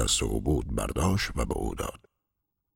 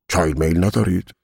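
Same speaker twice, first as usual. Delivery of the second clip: polite servant, higher and louder; eager servant; 6 semitones higher, 6 dB louder than usual. A narrator's reading. Servant offering his sick master tea, eager and polite. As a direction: lift the voice to offer service.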